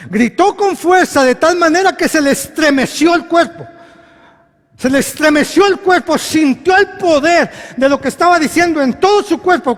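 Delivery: loud, high sermon delivery; fervent preaching. Acted. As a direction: proclaim it with full force. Predicación a toda fuerza. (predicación a toda voz)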